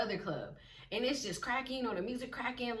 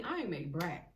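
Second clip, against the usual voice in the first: ordinary delivery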